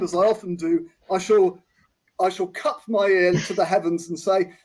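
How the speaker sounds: ordinary delivery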